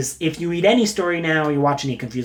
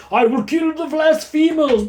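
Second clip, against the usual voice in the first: demon goblin threat; 10 semitones higher than usual. Acted. Demo Goblin threatening sinners, high villain cry. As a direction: wail it like a zealous cartoon demon.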